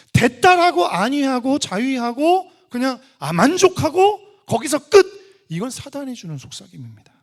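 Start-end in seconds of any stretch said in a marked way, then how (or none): 0.1-5.4 loud, high, slow emphatic denunciation; raised-voice sermon rebuke; forceful preaching. Real, not acted. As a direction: hammer out each phrase from the pulpit.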